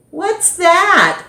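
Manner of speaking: put-on a girl curious at strange food; Madison's voice.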